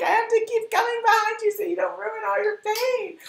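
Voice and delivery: very high, loud mock scolding; imitated assistant's yell. Assistant scolding her messy boss, hammed-up yell. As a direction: yell it in exaggerated exasperation. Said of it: "yelling at me every day".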